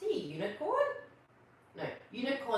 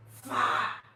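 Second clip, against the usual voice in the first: breathy blown fart sound; the big comic punchline; fart noise; 7 dB louder than usual. Acted. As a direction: blow it out like a raspberry.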